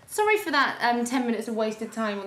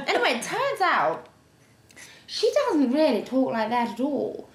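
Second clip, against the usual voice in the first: usual speaking voice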